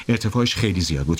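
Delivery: plain reading voice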